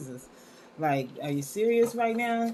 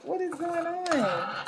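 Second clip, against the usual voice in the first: much higher, slow, stretched-out disbelief; mock disbelief; 11 semitones higher, slower than usual. Host acting out her own baffled reaction to a book, high and slow. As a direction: play up the baffled gasp. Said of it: "I'm like".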